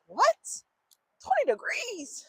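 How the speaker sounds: high, stretched-out incredulous squeal; mock disbelief. Vlogger hearing the wind chill, in mock disbelief. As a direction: climb way up in exaggerated shock.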